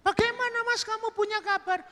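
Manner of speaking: squeaky-high falsetto questioning; woman's voice. Acted. Woman asking the visitor's name, high falsetto.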